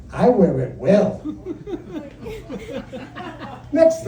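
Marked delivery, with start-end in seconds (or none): 0.1-1.2 a cocky husband boasting; smug. Retold or imitated. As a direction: lower and slow, self-satisfied. Smug reply to wife.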